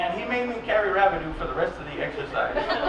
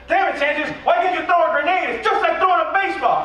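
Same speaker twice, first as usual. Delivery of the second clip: high, louder hammed-up yell; mock drill sergeant yell; 8 semitones higher, 3 dB louder than usual. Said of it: "drill sergeants were yelling at me".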